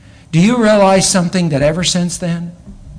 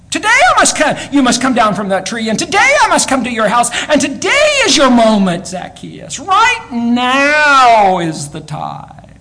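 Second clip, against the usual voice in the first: urgent call; 8 semitones higher, 4 dB louder than usual. Acted. Preacher voices Jesus calling Zacchaeus.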